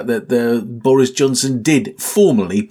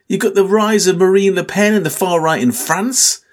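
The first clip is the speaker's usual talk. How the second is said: ordinary talk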